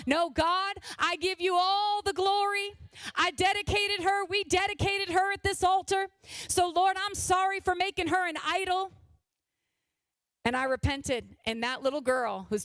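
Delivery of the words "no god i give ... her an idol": lifted, higher and louder prayer; impassioned prayer. Acted. Mother praying aloud over her daughter, impassioned. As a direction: cry out to God in praise.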